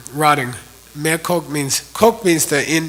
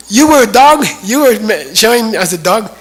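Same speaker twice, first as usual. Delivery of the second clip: astonished; 5 semitones higher, 6 dB louder than usual. Retold or imitated. Realizes Maitreya was the dog.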